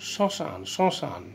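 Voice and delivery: শ্মশান twice, very slow, first syllable stressed and nasal; stressed nasal demo. Shown as a demonstration. Teacher repeats শ্মশান for students, slow with nasal stress. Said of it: "কিছুটা stress দিয়ে এটা উচ্চারণ করতে হবে".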